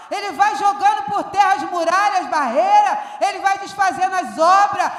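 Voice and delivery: loud, driving pulpit preaching; fiery preaching. Acted. Pastor rallying the church with loud, driving preaching. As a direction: preach it to the back row, full force. Preaching full force to congregation.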